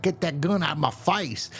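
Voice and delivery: high-pitched retold outburst, no real volume; lightly acted angry snap; mock angry yelling. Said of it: "everyone's bickering and screaming at each other".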